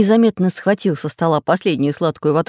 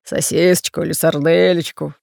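lightly voiced greedy pirate bass. A narrator's reading. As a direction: order food in a slight pirate bass. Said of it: "тяжело дыша пробасил пират Бульбуль".

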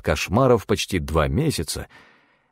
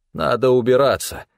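higher, controlled urging; coolly focused character voice, raised in pitch; cold, focused; 6 semitones higher, 4 dB louder than usual. A narrator's reading. Stefan warns his partner to get away, cold and focused. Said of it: "Теперь его голос был холоден и сосредоточен".

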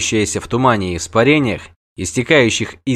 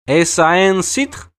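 very slow, high question; a dryad asks the witcher's companion a question; curious dryad; 7 semitones higher, much slower than usual. Acted. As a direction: draw the foreign question out, curious. Dryad asking in Elder Speech.

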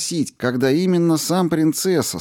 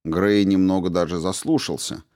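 ordinary delivery

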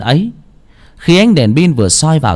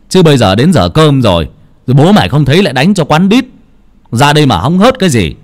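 scolding; 5 semitones higher, 5 dB louder than usual. A narrator's reading. Uncle scolds boy again.

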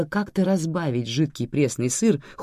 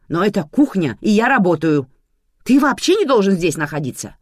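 rising to a louder, higher outrage; indignant; 6 semitones higher, 7 dB louder than usual. Acted. Manana telling Sergo off for being in her kitchen, indignant. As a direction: start polite, then snap indignantly. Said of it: "возмутилась Манана".

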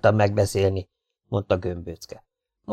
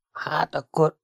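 higher, softer, unsure lead-in; hesitant; 6 semitones higher, 4 dB quieter, slower than usual. A narrator's reading. Gömböcke stalls while answering Bordin, hesitant. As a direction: start a thought you can't finish. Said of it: "felelte Gömböcke".

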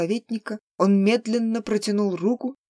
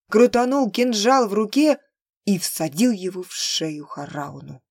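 usual speaking voice

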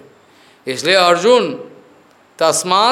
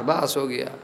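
ordinary delivery

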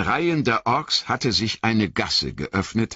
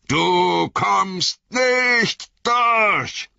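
Gandalf's defiance; 11 semitones higher, slower than usual. Acted. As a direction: build into a commanding roar. Wizard defying the Balrog.

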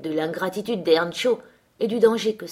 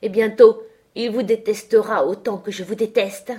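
loud, high outburst of hatred; vehement, raised voice; hateful defiance; 4 semitones higher, 8 dB louder than usual. A narrator's reading. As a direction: fling the hatred in the master's face. Girl declares hatred of master.

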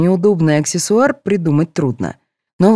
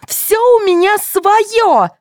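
very high, slow protest; outraged high insistence; indignant; 3 dB louder, much slower than usual. A narrator's reading. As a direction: insist furiously, drawn out. Girl defends her hair.

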